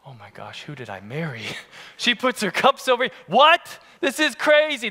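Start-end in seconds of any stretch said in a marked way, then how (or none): 0.1-1.4 aghast aside. Retold or imitated. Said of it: "I was like". 3.3-4.9 outraged disbelief. Retold or imitated. Outraged about cups.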